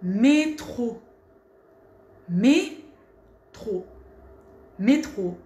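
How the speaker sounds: métro with a clean closed é; teacher modeling métro. Shown as a demonstration. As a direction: repeat métro slowly for the class, é closed and tight. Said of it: "Ici, vous voyez".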